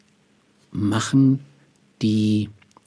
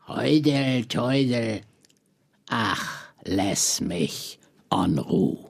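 hammed-up stinking mad; 4 semitones higher than usual. Poet reads his children's insult poem aloud, mock furious. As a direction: recite mock insults one by one with long pauses, sinking to a weary close. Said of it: "da ist ja jemand wirklich stinksauer".

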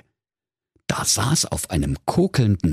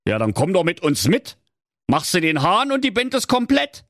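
higher-pitched donkey character; inviting donkey; 12 semitones higher than usual. Acted. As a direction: pitch the band offer as the donkey. (einladender Esel, höhere Stimme)

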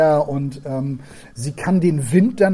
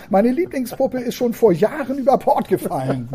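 put-on higher, chatty child; little girl's voice; 7 semitones higher than usual. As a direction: tell it like a sad little secret. Girl telling about her doll.

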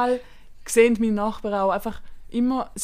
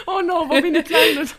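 lachend gespieltes Entsetzen; hoch und lachend: wo bin ich hier; entsetzte Oma; 6 semitones higher, 3 dB louder than usual. Retold or imitated. Spielt die Nonna nach. (Freundin spielt die erschrockene Oma nach, lachend)

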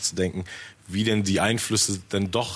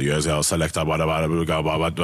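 imitating a jungle MC; 4 semitones lower than usual. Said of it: "mit einer tiefen Stimme über Beats labert".

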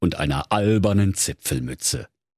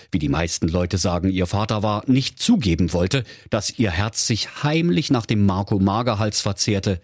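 usual speaking voice

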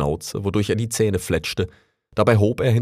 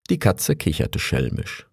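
ordinary delivery

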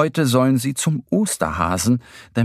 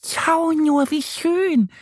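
very high squeaky duck voice; calling little duck; slower than usual. A narrator's reading. Annie the duck showing Mo the flowers, calling out. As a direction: exclaim high as a delighted duck. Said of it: "ruft Annie".

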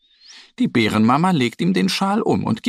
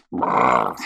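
low shivering brrr from the cold; 13 semitones lower than usual. A narrator's reading. Little bear touches the icy handlebar, low shivering brrr. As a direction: let the lips shiver with cold. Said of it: "macht er".

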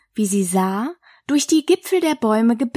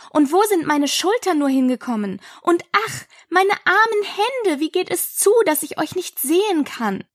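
higher-pitched, plaintive Alice; dismayed Alice; 7 semitones higher than usual. A narrator's reading. Alice looks for her lost hands, dismayed. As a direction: lament in a high child's voice.

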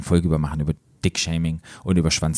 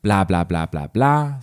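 skim over it with light mockery; dismissive filler; much slower than usual. Reader skipping part of the story, lightly dismissive. Skips text with mocking filler.

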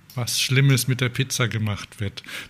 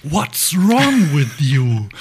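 slow, drawn-out, incensed chef impression; hammed-up exasperated outcry; mock outburst; 4 dB louder, much slower than usual. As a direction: erupt like an appalled Italian chef. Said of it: "dann bricht's aus ihm heraus".

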